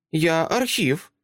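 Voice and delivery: put-on high child voice, slow and certain; matter-of-fact little girl. Girl tells Dresden what she is, slow and certain. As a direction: state it simply, like a fact.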